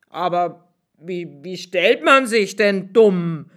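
lightly voiced blacksmith asking how; a hint of a baffled smith; blacksmith's voice.

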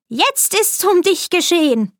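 höher, triumphierende böse Alte; boshaftes Weib. A narrator's reading. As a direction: sprich es hämisch und siegessicher. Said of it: "sprach das boshafte Weib". (die Stiefmutter über dem bewusstlosen Mädchen: höher und hämisch)

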